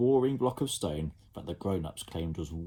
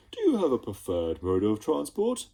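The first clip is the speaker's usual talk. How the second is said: louder mannered Slime voice; posh creature; 4 semitones higher, 5 dB louder than usual. Acted. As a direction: Slime being polite. Slime polite.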